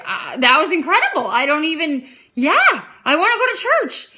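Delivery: high, loud, building excitement; retold amazement. She accepts friend's church invitation, retold excited outburst. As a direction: gush it, climbing to a high emphatic yes.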